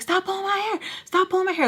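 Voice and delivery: high-pitched mid-fight protest; protesting cry. Retold or imitated. Woman to Sugar in a hair fight, high protest. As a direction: squeal it out as if being yanked.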